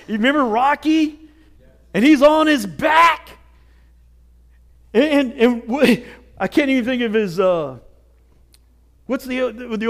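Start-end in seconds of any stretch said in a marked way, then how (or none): none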